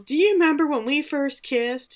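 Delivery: high, loud melodramatic cry; hammed-up desperate call; mock yell. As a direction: yell it like a soap heroine. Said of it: "And she yells".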